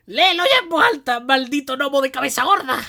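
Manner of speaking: high, loud yelled insult; hammed-up furious shriek; angry shout. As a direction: yell it like a raging creature. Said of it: "llegó a gritarle".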